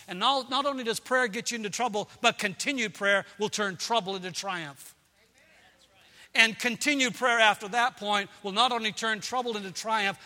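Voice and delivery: high, loud, rising sermon climax; full-force preacher's crescendo; preaching at full force. Acted. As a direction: build the congregation toward triumph.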